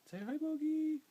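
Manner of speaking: high sing-song pet voice; high dog-talk voice. Real, not acted. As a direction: coo at the dog in a high pitch. Talking to the dog.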